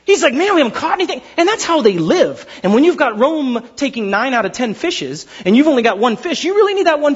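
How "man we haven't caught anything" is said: upset fisherman. Retold or imitated. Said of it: "he's probably upset".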